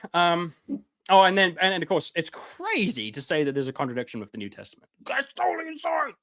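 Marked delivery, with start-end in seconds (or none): 5.0-6.1 high mocking exclamation; sarcastic mock. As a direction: parody the scandalized critics. Mocking critics of his view.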